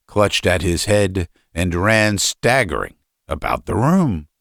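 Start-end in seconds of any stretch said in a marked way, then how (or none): none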